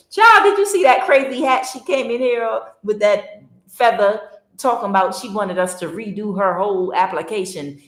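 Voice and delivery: high, loud sassy gossip voice; gossipy coworker. Retold or imitated. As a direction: dish the gossip like a coworker after hours. Gossiping coworker after closing.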